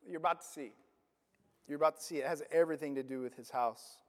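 low, soft knowing aside; hushed tease. Real, not acted. Preacher teasing the congregation about what comes, low hushed aside. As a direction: drop the voice like letting them in on a secret.

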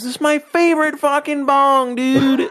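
high, hammed-up voice of the angry owner; dog owner's high mock whine. Retelling the owner's rage over the broken bong, high mock whine. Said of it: "He goes".

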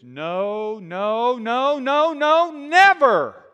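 mounting refusal ending in a long shouted never; building to a shout. Retold or imitated. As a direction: start firm and build to a full shout. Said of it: "That's how he would say it".

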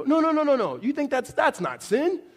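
high, loud rush of reassurance; hammed-up, high-pitched denial; mock protesting. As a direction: protest in exaggerated disbelief. Eases someone's guilt after a confession.

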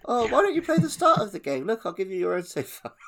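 high, quick, sugary coaxing voice; fake cheerful adult. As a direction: coax the child away with false cheer. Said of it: "And it's like".

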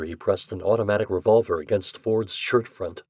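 ordinary delivery

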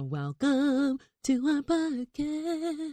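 high, slow hammed-up host welcome; hammy slow welcome. Shown as a demonstration. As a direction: drag out our like a cheesy host. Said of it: "That was bad".

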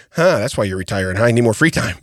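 low, deadpan retort; straight-faced. Retold or imitated. Patient needling his doctor about retiring, straight-faced and low. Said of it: "with a straight face".

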